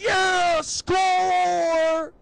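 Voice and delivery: high, loud, drawn-out whoop; triumphant cheer. Retold or imitated. Guy greeting girlfriend with a big pizza, exultant shout. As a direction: celebrate like you just scored.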